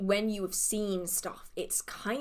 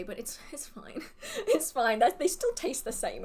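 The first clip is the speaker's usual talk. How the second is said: laughing; 5 semitones higher than usual. Real, not acted. Biscuits sat on, laughing.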